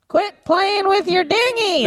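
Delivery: high synthetic robot voice. Shown as a demonstration. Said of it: "the robot thing's weird".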